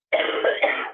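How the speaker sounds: harsh coughing fit; chesty cough. Real, not acted. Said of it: "sorry, I have a co-, cough".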